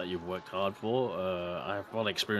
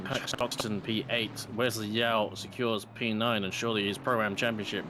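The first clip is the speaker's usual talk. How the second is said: ordinary delivery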